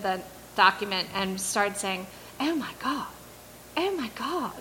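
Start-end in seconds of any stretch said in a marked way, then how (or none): 2.4-4.5 a light British intern gasping, higher, building to loud; British-accented oh my God growing from hushed to a burst. Retold or imitated. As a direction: imitate the British intern's rising excitement. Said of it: "like, in her British, cute British accent".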